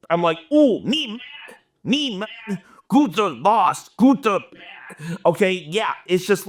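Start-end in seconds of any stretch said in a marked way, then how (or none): 0.5-4.4 slow, mock caveman grunting; inarticulate caveman. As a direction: play yourself riled up and reduced to brute talk. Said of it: "I no longer have really cool, elegant words to say".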